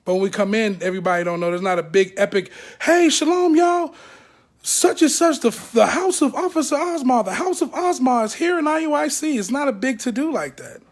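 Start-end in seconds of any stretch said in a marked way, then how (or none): 2.8-9.4 pitched higher, a hammed-up herald calling out the arrival; mock grand announcement. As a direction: announce it like a big event.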